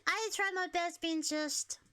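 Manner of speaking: ordinary talk, nothing marked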